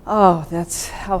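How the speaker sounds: usual speaking voice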